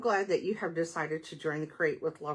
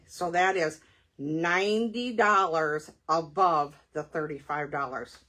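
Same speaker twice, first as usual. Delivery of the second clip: ordinary delivery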